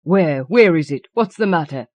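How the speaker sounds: loud, startled alarm; jolted awake in alarm. A narrator's reading. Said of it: "He started up in alarm".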